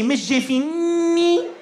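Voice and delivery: "fini" with a long, high, held "niii"; exaggerated N emphasis. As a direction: lean hard on the N of "fini" and stretch it. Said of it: "put an emphasis whenever there's a letter N".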